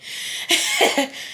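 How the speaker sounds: squeaky, breathless giggle; high wheezy laugh. Real, not acted. Laughs at own retold car scene.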